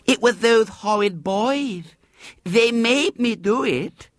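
put-on high, slow, whiny child's plea; plaintive little girl. A narrator's reading. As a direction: whine it innocently to escape blame. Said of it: "she said plaintively".

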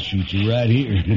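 ordinary talk, nothing marked

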